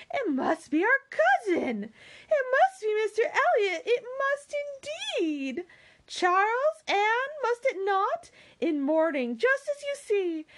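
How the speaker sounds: excitable Mary. Acted. Mary to Charles and Anne about their cousin, excited. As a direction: play a thrilled woman, high and emphatic.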